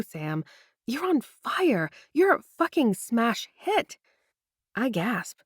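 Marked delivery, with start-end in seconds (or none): 0.9-4.0 excited praise. Acted. Friend gushes over her success.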